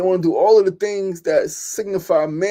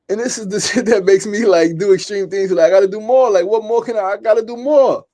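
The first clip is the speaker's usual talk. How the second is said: rising, urgent outburst; 4 semitones higher, 7 dB louder than usual. Real, not acted. Pushing himself, ranting hard.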